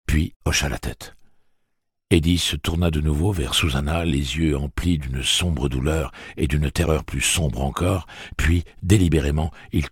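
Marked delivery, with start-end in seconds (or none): none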